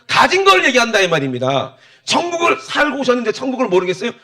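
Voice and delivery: high, loud preacher driving the point home; impassioned preaching. Real, not acted. (목소리 높여 열변하는 설교)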